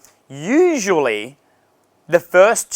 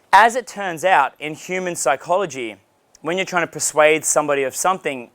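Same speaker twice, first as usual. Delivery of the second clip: ordinary talk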